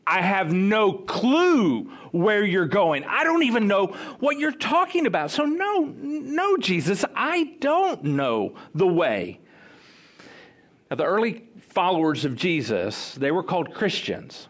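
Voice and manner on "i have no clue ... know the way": baffled protest climbing high, then slowing firmly; acted exasperation. Disciple tells Jesus he is clueless, in acted exasperation. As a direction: play utterly lost and fed up.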